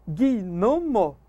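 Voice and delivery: "gnomo" chopped into gui, no, mo; "gnomo" syllable by syllable, adding gui as its own beat; wrong split in three. Shown as a demonstration. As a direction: say "gnomo" as three separate beats with pauses. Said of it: "Claro que não".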